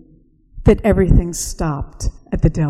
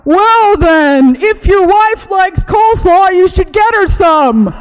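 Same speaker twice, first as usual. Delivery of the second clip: put-on much higher and louder, a fussy old man; old shopkeeper voice; 12 semitones higher, 7 dB louder than usual. Mister Eldridge advising the customer about coleslaw, high and fussy. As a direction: play him as flustered but polite.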